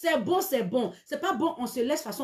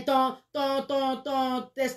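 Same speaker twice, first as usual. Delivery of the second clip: ordinary talk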